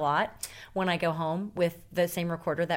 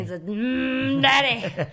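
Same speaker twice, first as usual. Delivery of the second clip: sultry tease; 7 semitones higher, 8 dB louder, much slower than usual. Pretend. Praising guest as a daddy.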